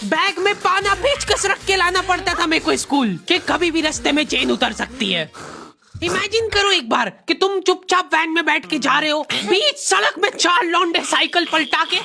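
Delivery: usual speaking voice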